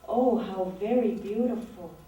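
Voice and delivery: usual speaking voice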